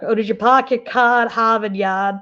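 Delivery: imitating a broad Boston accent with dropped R's; exaggerated Boston accent, vowelized R's; thick Boston accent. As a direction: mimic a Bostonian teasing, R's turned to open vowels. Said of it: "a real thick Bostonian accent".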